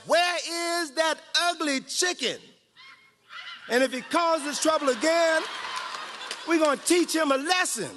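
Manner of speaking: lightly voiced rooster threat; preacher playing a rooster; chicken talk. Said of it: "chicken talk".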